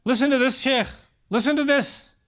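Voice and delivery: a mild nagging mother; insistent mom. Retold or imitated. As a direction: urge them, nagging. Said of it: "Your mother says".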